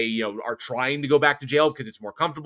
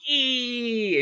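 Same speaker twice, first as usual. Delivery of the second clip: high, drawn-out "eee"; mock squeal held on one long note; imitated shriek; 10 semitones higher than usual. Host imitating old lady charging across the room, mock shriek.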